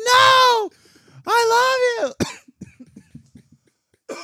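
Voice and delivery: very high, loud hammed-up wail; mock anguished shout. Acting out the woman's cry, high mock wail. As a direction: stretch the love into a pained yell. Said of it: "She's like".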